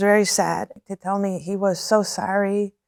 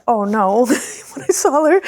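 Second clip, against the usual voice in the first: laughing dismay; 4 dB louder than usual. Retold or imitated. Retold dismay at tough woman.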